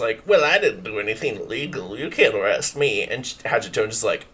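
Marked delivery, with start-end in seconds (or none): 0.3-3.0 character voice. Acted. Banshee defends himself.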